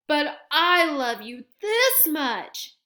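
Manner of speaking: loud, slow and tender big hare; slow fond boast to a little one; big hare gently outdoing the little one. Acted.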